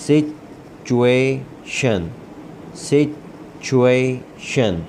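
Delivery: very slow, syllable by syllable; slow drawn-out syllables. Shown as a demonstration. Teacher modelling situation slowly for students. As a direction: stretch every sound of situation for the learner.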